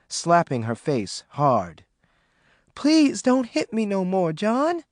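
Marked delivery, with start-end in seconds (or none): 2.7-4.8 higher, pleading plea; begging. Acted. Maureen begging John not to hit her again. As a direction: beg him to stop. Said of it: "Maureen begged".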